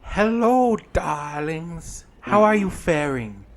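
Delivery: campy drag queen. Acted. GM voices Granny Who.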